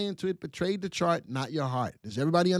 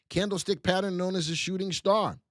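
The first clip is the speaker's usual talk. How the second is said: ordinary delivery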